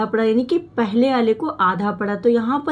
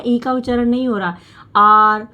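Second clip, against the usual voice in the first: ordinary delivery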